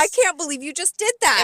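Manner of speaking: high, loud scolding; indignant outrage. Retold or imitated. Server retelling a quarrel with the busser, loudly indignant. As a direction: act out being utterly appalled. Retold quarrel over the pen.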